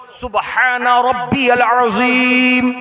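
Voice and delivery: slow, sonorous intoned prayer; unhurried recitation. Shown as a demonstration. Preacher showing worshippers how to bow in prayer, unhurried recitation. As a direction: draw each word out, full-voiced and composed. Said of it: "इत्मीनान से बोलो".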